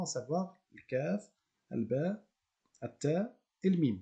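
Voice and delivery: ordinary talk, nothing marked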